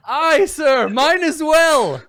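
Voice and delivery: very high, loud, stretched-out holler; knight's yell. Acted. Second knight reporting his lost torch, comic yelling. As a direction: yell back as a hapless soldier, hammed up. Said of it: "another one yells".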